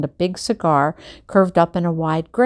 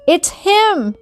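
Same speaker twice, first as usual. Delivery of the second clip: excited; 11 semitones higher, 4 dB louder than usual. A narrator's reading. Dad beaming like a boy.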